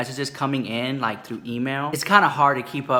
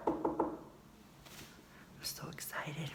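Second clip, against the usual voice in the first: whisper; 2 semitones higher, 19 dB quieter, much slower than usual. Real, not acted. Waiting for door knock.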